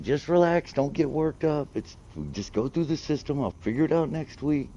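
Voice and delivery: high, loud call to the next room; hollered through a wall. Retold or imitated. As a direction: shout it to the sister behind the wall to calm her down. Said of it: "kinda hollered at her through the other room".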